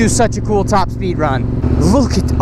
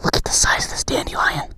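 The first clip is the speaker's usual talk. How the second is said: soft, hushed whisper; 6 dB quieter than usual. Real, not acted. Vlogger showing viewers a big dandelion, whispering. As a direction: whisper it as a small marvel. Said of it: "I don't know why I'm whispering".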